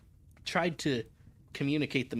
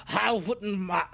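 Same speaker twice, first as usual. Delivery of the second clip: drawn-out attempt at a rustic accent; broad, slow country accent, a false start; weird rustic accent; 5 semitones higher, slower than usual. Old sailor brusquely dismissing the legends, weird rustic accent. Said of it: "that is weird accent coming out there".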